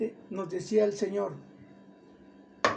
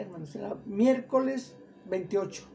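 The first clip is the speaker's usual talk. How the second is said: ordinary delivery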